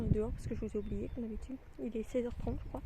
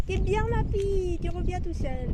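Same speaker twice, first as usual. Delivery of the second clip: praising a dog; 7 semitones higher, 5 dB louder, slower than usual. Real, not acted. Praising dog coming back.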